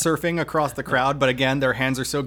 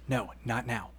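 whispered-soft hush to her son; quietly shushing him, much softer; hushed mom; 3 semitones lower, 9 dB quieter than usual. Acted. Mom hushing son in story.

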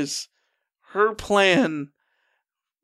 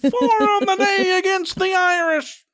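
radio announcer; 10 semitones higher than usual. Pretend. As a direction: shout the play like an old-timey broadcaster. Mock radio call continues.

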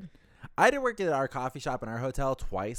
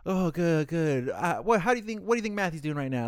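higher, cheerful small-talk voice; chirpy bellman; 7 semitones higher, 3 dB louder than usual. Retold or imitated. The bellman asking about Matthew, higher and cheerful. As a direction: keep the friendly coworker chatter bubbly. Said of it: "he was just like".

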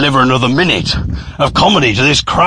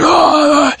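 high-pitched imitated roar; drunken crowd cheer; 13 semitones higher than usual. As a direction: whoop like a tipsy audience. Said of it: "were really drunk".

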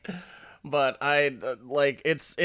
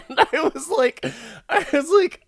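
high-pitched laughing lead-in; 4 dB louder than usual. Real, not acted. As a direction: lachend. Laughing while recapping scene.

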